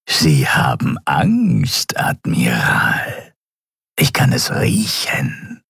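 slow, close, menacing whisper; taunting whisper. Acted. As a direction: whisper right in his face. (langsames, drohendes Flüstern)

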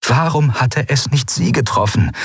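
plain reading voice

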